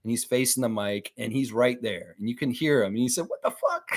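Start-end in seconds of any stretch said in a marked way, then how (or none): 3.3-3.9 high, squeaky imitated "What the fuck?"; mock outburst. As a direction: squeal it like the player did. Quoting a player's outburst.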